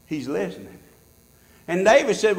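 usual speaking voice

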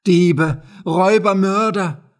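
loud, high cries of outrage; screamed accusation. A narrator's reading. Poultry seller accusing the officials, crying out loudly. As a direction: hurl each word as an accusation. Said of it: "schrie sie".

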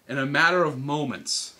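ordinary delivery

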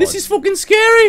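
very high-pitched, loud alarm; frightened squeal. Real, not acted. Player spooked by the game, frightened squeal. Startled, says game is scary.

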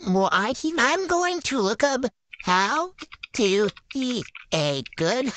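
ordinary delivery

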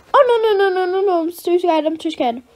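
loud, high panicked no's; a terrified child shrinking from the dog; frightened cry. Acted. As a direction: cry out in terror. Child screams in fear.

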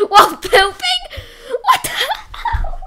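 loud, high squeal of disbelief with laughter; laughing shriek. Real, not acted. Player squealing at a ridiculous scene, laughing. Shocked at in-game antics.

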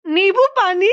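high incredulous echo; amused disbelief. Real, not acted. Mother hearing her child's funny answer, amused high-pitched disbelief. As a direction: echo it back high, about to crack up.

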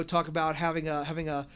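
ordinary delivery

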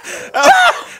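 high shrieking laugh; hysterical laugh. Real, not acted. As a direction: burst out laughing. Watching friend fail.

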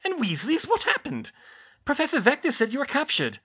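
high, loud, flustered matron; alarmed questioning. A narrator's reading. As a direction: demand answers in startled alarm. Matron alarmed by injured students.

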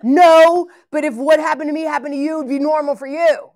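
high, loud retold yell of a defensive man. Gang member shouting at the pastor about his fear, yelling. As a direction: shout it back as if cornered. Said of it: "he's full on yelling".

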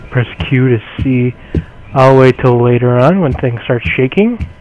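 normal talking voice

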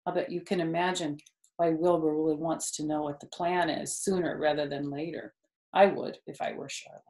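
plain reading voice

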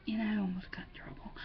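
unvoiced, quick confiding aside; whispered confession to viewers. Real, not acted. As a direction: let them in on the secret in a whisper.

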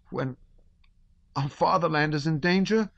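put-on high, shrill boy forcing it out; flushed boy. A narrator's reading. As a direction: push the words out, embarrassed. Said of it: "flushed till he perspired".

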